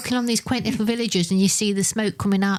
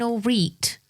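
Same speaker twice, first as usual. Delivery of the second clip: Mansfield accent. Shown as a demonstration. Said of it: "Mansfield saying".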